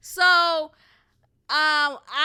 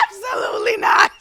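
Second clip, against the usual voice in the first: loud laughing no, pitched up; laughing refusal; 8 semitones higher, 12 dB louder than usual. Retold or imitated. Reliving her reaction to the job interview, laughing shout. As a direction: shout it while cracking up. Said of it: "I was like".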